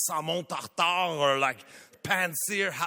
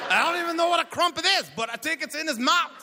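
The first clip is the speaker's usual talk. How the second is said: comic shout; 7 semitones higher than usual. Real, not acted. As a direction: blurt it out in mock outrage. Comic outburst about crumpets.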